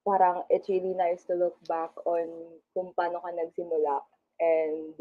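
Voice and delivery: usual speaking voice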